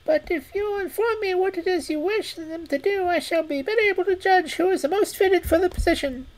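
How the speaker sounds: very high squeaky rat voice; eager, relieved little rodent. Acted. Rat speaks.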